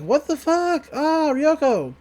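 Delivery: high startled cry, drawn out slowly; shocked outburst. Retold or imitated. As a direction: gasp it out, stunned by the hit. Character reacts to blast.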